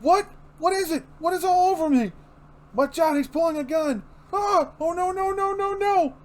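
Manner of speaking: high desperate voice rising into no no no; cornered man begging; terrified pleading. Acted.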